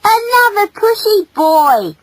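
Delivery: high, loud scolding; exasperated, indignant girl; angry female. Acted. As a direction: snap it in high-pitched indignation. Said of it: "cried the female angrily".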